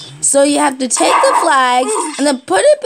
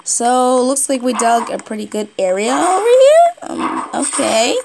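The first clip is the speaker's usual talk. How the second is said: normal talking voice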